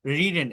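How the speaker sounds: রিডেন with a short "ri"; correct short i. Shown as a demonstration. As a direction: repeat রিডেন as "ri-den" for learners. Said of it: "আমি আবারও বলছি রিডেন".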